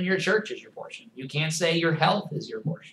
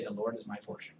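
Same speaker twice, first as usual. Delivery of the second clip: quiet declaration; 6 semitones lower, 13 dB quieter than usual. Shown as a demonstration. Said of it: "this is something you need to say".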